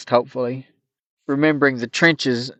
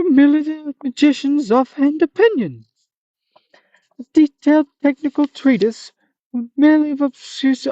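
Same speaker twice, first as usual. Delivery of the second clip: a hammed-up high monster; Manticore voice; 10 semitones higher than usual. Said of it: "Hope you enjoyed my funny voice".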